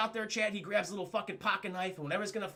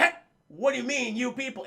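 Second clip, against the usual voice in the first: mildly raised challenge; imitating an affronted actor; acted indignant retort; 4 semitones higher than usual. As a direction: challenge it in character, a notch up. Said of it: "And he's like".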